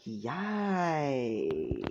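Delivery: long, stretched-out YIKES sliding down; drawn-out yikes. Acted. Nezu reacts to an angry Izuku, long drawn-out yikes. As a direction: draw YIKES right out, letting it fall. Retake of Nezu's startled reaction.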